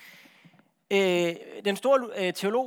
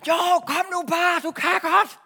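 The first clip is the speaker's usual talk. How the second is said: high, loud, drawn-out urging call; hammed-up shouted encouragement; calling across; 11 semitones higher, 8 dB louder, slower than usual. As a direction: coax a nervous jumper from far off. Acting God calling across the chasm.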